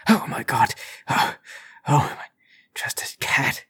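breathless gasp sinking to a whisper; startled fright. Acted. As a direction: catch your breath after a fright, softly. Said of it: "he nearly has a heart attack".

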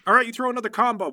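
ordinary talk

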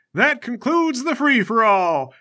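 high, slow arena announcement; announcing judge. Acted. Judge ending the fight before the crowd, announcing. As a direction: proclaim the end of the contest. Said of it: "A judge walks out into the field and says".

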